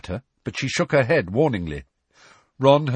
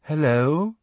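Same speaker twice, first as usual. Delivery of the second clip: vague, airy, higher hello; dreamy voice; 6 semitones higher than usual. A narrator's reading. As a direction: float it out dreamily. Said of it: "a vague and dreamy voice".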